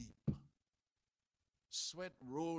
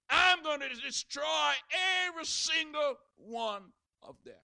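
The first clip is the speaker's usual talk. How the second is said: loud, high furious divine threat; angry God; 10 semitones higher, 13 dB louder than usual. Acted. God telling Moses he will destroy them, furious shout. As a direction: thunder out God's fed-up verdict.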